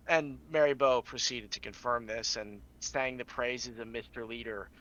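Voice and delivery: ordinary talk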